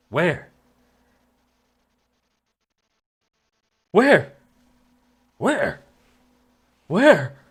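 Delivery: loud, high demanding where; alarmed question. Acted. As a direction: snap it out urgently. Audition takes of one word.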